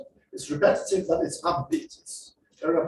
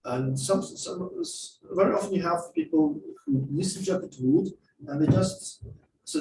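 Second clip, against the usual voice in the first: ordinary talk, nothing marked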